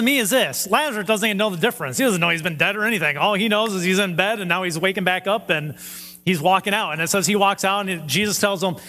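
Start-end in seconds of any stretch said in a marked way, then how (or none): none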